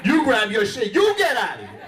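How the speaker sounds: mock angry girlfriend. Girlfriend yelling back.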